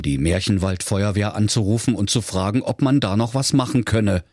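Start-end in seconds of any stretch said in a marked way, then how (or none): none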